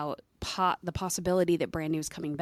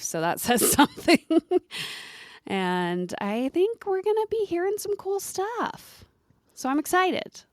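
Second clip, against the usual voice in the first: excited; 13 semitones higher than usual. Real, not acted. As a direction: gush about the band. Excited about band's return.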